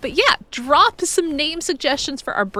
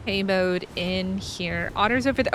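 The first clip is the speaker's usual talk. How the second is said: ordinary talk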